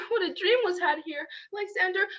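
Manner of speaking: normal talking voice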